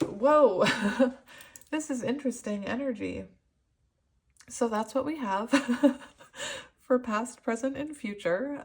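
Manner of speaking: overcome, laughing between phrases; emotional, giggly. Real, not acted. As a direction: keep losing it to teary giggles. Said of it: "I'm feeling so emotional".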